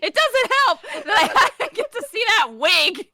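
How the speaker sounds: laughing. Real, not acted. Laughter at the wig.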